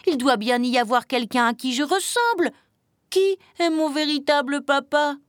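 high, pleading chick voice; small one desperate for an answer; desperate little chick. A narrator's reading. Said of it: "le petit poussin vert désespéré".

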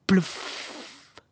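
long airy "plouf" noise; voiceless plop into water; fish's splash. A narrator's reading. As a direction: imitate a splash with a puff of air. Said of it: "fit le poisson".

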